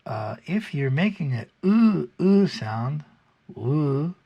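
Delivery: oo with tongue lying flat. Shown as a demonstration. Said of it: "the tongue is flat".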